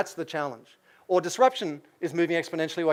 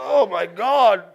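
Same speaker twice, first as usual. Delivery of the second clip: loud, drawn-out mock amazement; mock awe; 3 semitones higher, 9 dB louder, much slower than usual. Imagining how future people will gush, in mock awe. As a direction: stretch it out in exaggerated wonder.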